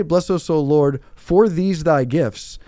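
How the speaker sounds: slow, stressing "for"; mock the wrong wording, slow; wrong version. Shown as a demonstration. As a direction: drag out "for these thy gifts". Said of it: "But when you say".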